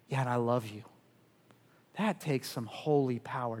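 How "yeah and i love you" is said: soft, low and tender; tender Jesus. Acted. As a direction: say it gently, as to someone ashamed. Jesus speaking to sinners.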